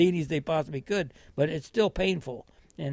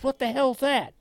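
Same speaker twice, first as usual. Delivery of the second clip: mock angry nurse; 9 semitones higher, 3 dB louder than usual. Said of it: "she was just mad as hell at me".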